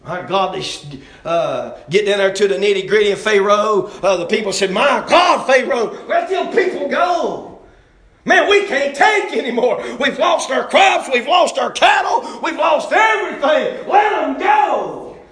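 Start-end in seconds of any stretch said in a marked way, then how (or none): 4.7-15.2 desperate begging shout. Acted. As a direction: beg Pharaoh at full voice, voice climbing. People begging Pharaoh to release.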